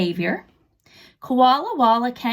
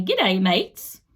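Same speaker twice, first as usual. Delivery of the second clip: Australian accent; 3 semitones lower than usual. Shown as a demonstration. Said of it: "somebody who speaks Australian".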